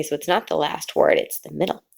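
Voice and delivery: ordinary delivery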